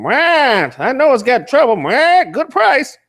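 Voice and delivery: mock car salesman. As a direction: ham up a sleazy old car dealer. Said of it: "You just".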